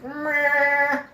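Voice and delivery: high, drawn-out bleating meh; mock bleat of indifference; dismissive meh. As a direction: stretch out an unimpressed noise. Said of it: "I was like".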